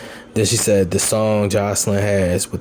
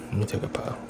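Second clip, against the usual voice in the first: breathy whisper while drawing smoke; breathy whisper, lips on the joint; 11 dB quieter, faster than usual. Real, not acted.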